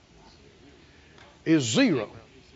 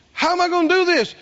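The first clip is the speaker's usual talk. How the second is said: higher, fast, fretful question; Moses doubting; 9 semitones higher, 3 dB louder, faster than usual. Retold or imitated. Moses asking God anxiously, pitched up and quick. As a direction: lightly play a worried Moses. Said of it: "God said, you know".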